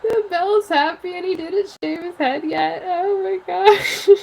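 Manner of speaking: high, drawn-out fake sob; mock crying. Fan reliving the episode, mock crying. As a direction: whimper it slowly, hamming up the tears. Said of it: "I was, like, crying and crying".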